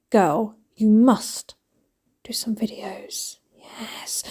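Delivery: whisper. Real, not acted. Vlogger whispering encouragement to At Home Girl. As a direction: whisper a playful urging.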